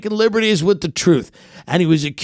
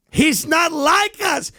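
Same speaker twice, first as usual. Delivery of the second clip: hammed-up shouting; 9 semitones higher, slower than usual. Obsessed fan yelling at his wife about the podcaster, hammed-up shout. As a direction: yell it like an unhinged superfan.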